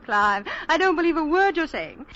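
high, loud, playful protest; delighted teasing. Acted. Tourist woman flirting with Clive, delighted teasing. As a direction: tease him, thoroughly charmed.

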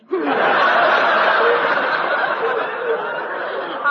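loud breathy laughter. Real, not acted. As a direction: burst out laughing. Actress bursts out laughing.

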